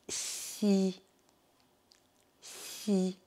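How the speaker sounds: "si" with a drawn-out palatal hiss and a clear i; soft palatal si. Shown as a demonstration. Teacher contrasts X I with S I, "si" with a clear i. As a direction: model "si" slowly, each alone. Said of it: "C'est là le si".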